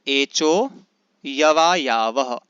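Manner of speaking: slow, drawn-out एचो यवा यावह, avagraha silent; sutra recitation. Shown as a demonstration. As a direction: recite एचो यवा यावह slowly and firmly, long vowels held. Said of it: "किस प्रकार से उच्चारण करेंगे". (सूत्र का धीमा, स्पष्ट पाठ)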